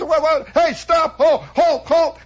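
flustered cop, mock hollering. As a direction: yell at fleeing bums like a flustered cop. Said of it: "he's hollering".

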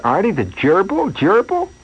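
high incredulous double take on gerbil; disbelief. Retold or imitated. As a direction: squeak it out as if you can't believe it. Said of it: "there's a moment of disbelief".

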